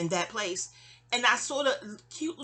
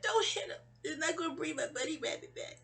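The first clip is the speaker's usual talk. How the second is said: much higher, pleading and fading; childlike pleading; 7 semitones higher, 5 dB quieter than usual. Retold or imitated. Child pleads with the mother beating the cat, high pleading. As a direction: beg her to stop, a tearful child. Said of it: "I'm like".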